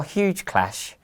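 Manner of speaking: drawn-out, ironic big-match billing; sarcastic. Real, not acted. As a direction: oversell it with sarcasm. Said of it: "I say that with sarcasm".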